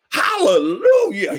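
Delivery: high, loud exultant cry; burst of hallelujah. Retold or imitated. Neighbor hears the father died, loud high hallelujah. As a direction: burst out with praise. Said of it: "he busted out".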